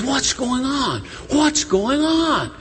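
high-pitched, urgent asking; anxious questioning. Acted. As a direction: call out the question, stretching it the second time. Blind man asking about the crowd.

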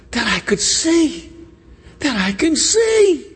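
high, rising yearning cry; plead, stretching the second time; building from plea to cry; heartfelt plea. Acted. Blind man pleads for sight.

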